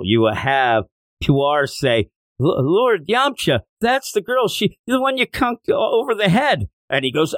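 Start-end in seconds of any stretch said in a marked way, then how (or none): 2.4-6.7 Puar's squeaky voice. Retold or imitated. Puar warns Yamcha.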